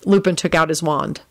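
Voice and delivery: plain reading voice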